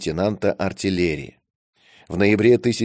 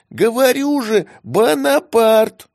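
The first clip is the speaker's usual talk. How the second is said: much higher, louder, insistent squeaky voice; stubborn squeaky kitten; 14 semitones higher, 5 dB louder, slower than usual. A narrator's reading. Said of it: "упрямо повторил местный житель".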